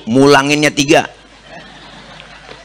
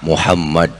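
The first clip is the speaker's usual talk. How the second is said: lower, softer answer; gentle Prophet; 9 semitones lower, 4 dB quieter than usual. Acted. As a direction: answer humbly from outside. (Nabi menjawab pelan dari luar)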